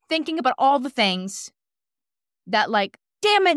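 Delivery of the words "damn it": loud, high burst of frustration; cursing. Retold or imitated. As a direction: curse it out sharply in retelling. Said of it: "I was cursing".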